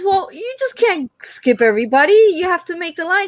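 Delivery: high, put-on clerk voice; scolding clerk. Clerk scolding the lady, high put-on voice. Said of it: "And he goes".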